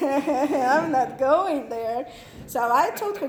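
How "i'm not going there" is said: slow, drawn-out mock refusal; teen self recoiling from rumors about Baptists; firm refusal. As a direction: stretch out each word, dead set against it. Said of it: "so I'm like".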